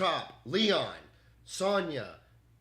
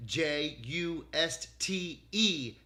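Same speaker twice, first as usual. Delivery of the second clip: slow letter by letter spelling; spelling out the name slowly for the AI; much slower than usual. Shown as a demonstration. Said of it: "with an E at the end".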